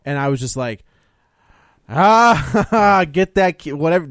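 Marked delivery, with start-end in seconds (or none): 1.9-4.1 high, loud outburst breaking into a laugh; mock alarm. Viewer watching the baby in the basement, mock alarm. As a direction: playfully cry out at the screen. Acts reaction to baby scene.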